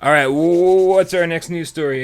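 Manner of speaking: high, loud show-host kickoff; hyped segment intro. Real, not acted. Podcast host handing off to co-host, hyped. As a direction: pump it up for the next story.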